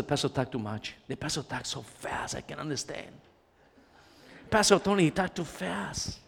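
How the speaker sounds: soft low grumbling complaint; grumbling congregant. Retold or imitated. Preacher mocks a congregant grumbling about Pastor Tony. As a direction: whine quietly about the pastor.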